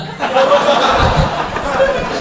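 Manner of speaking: a high, soft burst of laughter. Real, not acted. Laughing at own joke. (tawa tinggi yang lembut)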